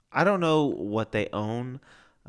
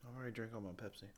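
talking into a cup while sipping; mumbled while drinking soda; 20 dB quieter, much faster than usual. Real, not acted. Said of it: "You're supposed to talk while I drink".

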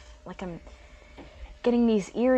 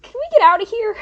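frightened plea; 9 semitones higher, 10 dB louder than usual. Acted.

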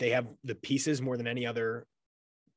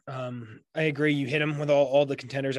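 normal talking voice

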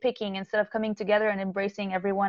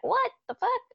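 high-pitched baffled exclamation; mock astonishment; 14 semitones higher than usual. People seeing her after hearing her music, high mock shock. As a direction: imitate stunned disbelief, pitch leaping way up.

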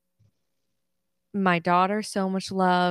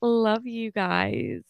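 getting emotional; 4 semitones higher, 4 dB louder than usual. Real, not acted. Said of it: "and I'm getting emotional".

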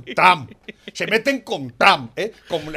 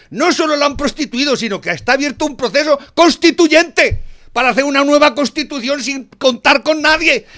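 loud, furious tirade, pitch raised; angry rant; 7 semitones higher, 6 dB louder than usual. Real, not acted. Ranting loudly at the comedians Gallego y Rey, furious. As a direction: shout him down in real anger.

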